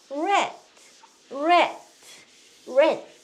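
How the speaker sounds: 렛 with rounded English r, one syllable; model English 'rat'. Shown as a demonstration. Teacher modeling for learners to repeat, 렛 as clear 'rat'. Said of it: "자꾸 따라서 하세요".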